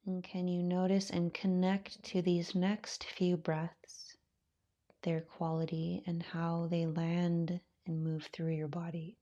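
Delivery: calm, unhurried voice with long pauses; guided meditation. Acted. Yoga teacher settling students into their breath, guided meditation. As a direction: ease them gently into stillness, softening as you go.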